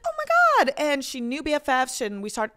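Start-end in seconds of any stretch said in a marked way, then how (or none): 0.0-0.7 high, loud squeal of "Oh my God"; mock posh gush. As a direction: squeal it like a delighted fan. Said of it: "And she was like".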